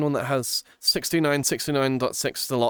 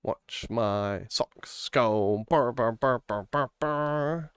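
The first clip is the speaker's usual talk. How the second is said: 1 mock purring; 2 semitones lower, much slower than usual. Imitating purring sound.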